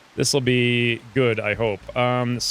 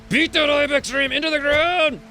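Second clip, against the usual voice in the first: cheering shout; 12 semitones higher than usual. Real, not acted. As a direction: yell encouragement at the fighter. Cheering on a colonist in a fight.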